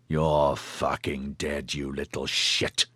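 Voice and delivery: low, slow snarled threat; low threatening thug. A narrator's reading. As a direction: growl it slowly like a thug. Thieves shout back a threat.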